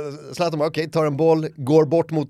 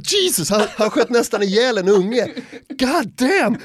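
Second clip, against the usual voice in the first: shocked; 9 semitones higher, 5 dB louder than usual. Retold or imitated. Shocked reaction retold.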